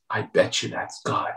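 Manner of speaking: hushed whisper of a sudden insight; whispered realisation. Retold or imitated. Eli whispering to himself as it dawns on him.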